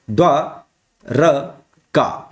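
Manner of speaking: द्वा-र-का spelled out very slowly, each syllable apart. Shown as a demonstration. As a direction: break द्वा-र-का into slow separate syllables. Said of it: "द्वारिका कैसे लिखा जाता है?".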